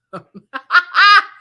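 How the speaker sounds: big loud laugh rising high; loud burst of laughter. Real, not acted. Host cracking up at the receipt, loud laugh.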